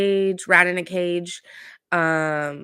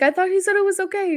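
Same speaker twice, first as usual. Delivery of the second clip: much higher, quick and pleading; a squeaky mock voice of someone caught out; squeaky meek excuse; 4 dB louder, faster than usual. [name] pleading with [name], high squeaky mock voice.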